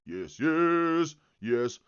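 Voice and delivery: loud repeated yes; loud test shout. Real, not acted. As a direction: call it out loud into the mic.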